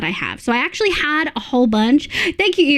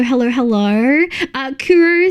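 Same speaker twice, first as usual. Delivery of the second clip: ordinary talk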